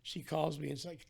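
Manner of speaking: usual speaking voice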